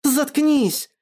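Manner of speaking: sudden high-pitched outburst; angry flare-up. A narrator's reading. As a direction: flare up and shut them down. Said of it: "вспылила Наташа".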